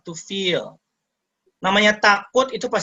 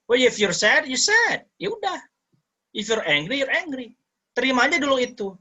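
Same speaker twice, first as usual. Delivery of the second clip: playful high voice; 9 semitones higher than usual. Shown as a demonstration. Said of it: "advice with playful high pitch".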